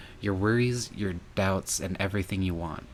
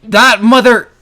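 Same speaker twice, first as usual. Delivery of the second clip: screamed at full force, much higher and louder; furious shout; 22 dB louder than usual. Acted. Cursing the betrayer in a furious scream. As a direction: explode with rage at the top of your lungs. Sudden outburst at the traitor.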